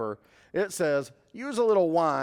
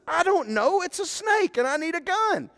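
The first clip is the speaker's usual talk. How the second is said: exasperated call; 11 semitones higher than usual. Retold or imitated. Husband shouting to his wife, exasperated high yell. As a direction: yell back, losing patience.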